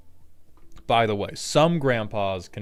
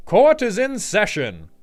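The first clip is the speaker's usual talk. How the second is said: loud, high mock British judge; attempted British accent; 11 semitones higher, 8 dB louder than usual. Announcing court in a rough British accent. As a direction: boom it out like a pompous British judge. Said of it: "You are gonna have to work on a British accent".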